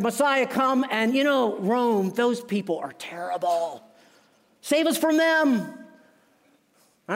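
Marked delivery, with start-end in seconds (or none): 0.0-5.6 pleading Israelites. Retold or imitated. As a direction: beg him to save you from Rome. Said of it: "They said".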